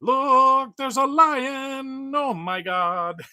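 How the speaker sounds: high, loud and slow, hammed-up amazement; mock awed exclamation. Vlogger translates the lyrics as a hammed-up awed cry. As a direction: announce the lion like it's a miracle. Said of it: "It means".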